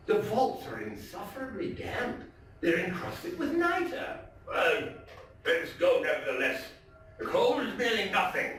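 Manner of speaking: dramatic, theatrical storytelling voice; story narration. A narrator's reading. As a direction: read it like a gothic tale told by the fireside. Dramatic reading of Poe story.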